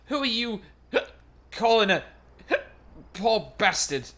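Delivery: offended yell broken by coughs; indignant coughing warrior. Acted. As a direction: snap back through a coughing fit.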